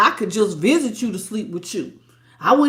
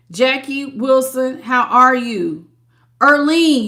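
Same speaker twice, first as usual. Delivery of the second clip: normal talking voice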